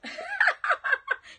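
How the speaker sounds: loud, high cackle; real shrieking burst of laughter; hearty laugh. Real, not acted. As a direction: crack up at yourself, loud and high. Laughs at her Southern phrase.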